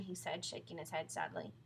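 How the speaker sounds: ordinary delivery, nothing marked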